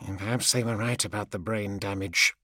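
low, soft grumbled aside; mumble. A narrator's reading. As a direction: mumble it to yourself. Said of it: "And then in a mumble".

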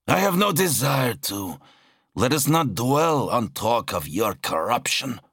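high-pitched refusal; dismissive warrior Zal. Acted. Zal refuses talk of his brother's corruption, dismissive. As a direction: shut the subject down, pitched high.

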